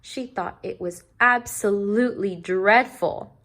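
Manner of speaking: ordinary delivery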